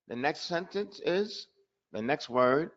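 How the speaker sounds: usual speaking voice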